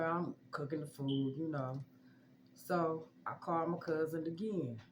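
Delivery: ordinary talk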